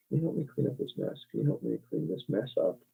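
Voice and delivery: testing the phrases under her breath; half-voiced self-check; muttered to herself. Shown as a demonstration. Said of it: "what one I would more naturally say".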